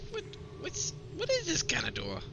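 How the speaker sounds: puzzled character voice. Acted.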